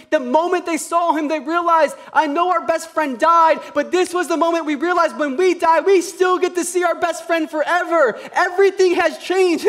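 high, loud passionate preaching; rising sermon fervor; preaching at full force. Acted. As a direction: preach it hard to the church. Preaching peak.

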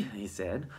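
ordinary delivery, nothing marked

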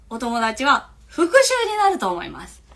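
usual speaking voice

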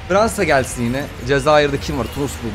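normal talking voice

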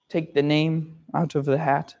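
ordinary delivery

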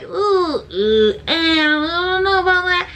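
doubtful ooh. Retold or imitated. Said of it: "I've had a couple that I'm like".

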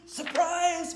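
very loud, high shout; sudden booming holler. A narrator's reading. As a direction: burst out with a surprise. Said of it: "Mister Bear shouted".

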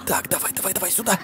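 very soft, almost whispered urging; hushed. Real, not acted. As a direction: keep it down so she won't hear. Sneaking, hiding from the ghost.

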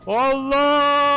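high, loud strangled cry; exasperated shout. Real, not acted. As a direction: burst out in frustration. Frustrated outburst in game. (öfkeyle yüksek sesli haykırış)

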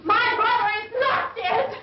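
high shouted refusal; frantic denial. Acted. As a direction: shout it down in disbelief. Denying brother is dead.

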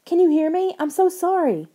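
high, loud pleading; desperate crying. A narrator's reading. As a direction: call to a frozen sister in despair. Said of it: "Lauren cried".

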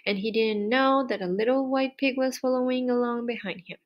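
usual speaking voice

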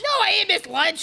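irritable, snapping at them; hangry. Acted. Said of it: "You sound, like, pretty hangry".

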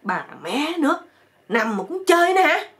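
high, loud comic outburst; hammed-up shouting at biting mosquitoes; pained yelling. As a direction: yell out in stung exasperation. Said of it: "Chích đau quá rồi ảnh mới la lên".

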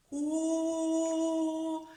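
Ко sung out long and high on the О; drawn-out vowel. Shown as a demonstration. Teacher sings Ко to show a vowel. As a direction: hold the О of Ко like a sung note. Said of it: "вимовляється гучно".

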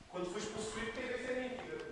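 hushed ominous tease. Real, not acted. Teasing a friend about being possessed, soft spooky warning. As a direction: drop the voice to a spooky hush.

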